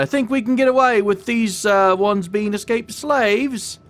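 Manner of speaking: a self-mocking British baddie voice; bad British accent. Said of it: "Sorry for the horrible British accent".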